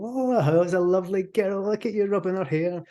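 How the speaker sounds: high, hammed-up pet talk; silly dog-cooing voice. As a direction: coo at a friend as if at a dog. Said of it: "do something silly like".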